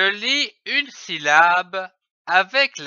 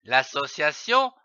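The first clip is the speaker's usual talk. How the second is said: ordinary delivery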